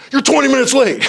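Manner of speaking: high, loud hammed-up yell; mock angry scolding. Shown as a demonstration. Supervisor to a late supervisee, mock furious shout. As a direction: blow up at a latecomer, overdone. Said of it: "Rather than going".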